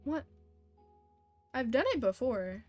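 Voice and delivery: usual speaking voice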